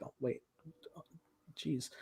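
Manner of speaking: quiet dismay. Retold or imitated. Peter taking the news in.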